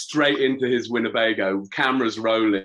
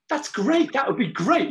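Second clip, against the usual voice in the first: squeaky high eager voice; high mock enthusiasm; 13 semitones higher than usual. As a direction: pitch it way up, overkeen. [name] imitating the narrator eagerly.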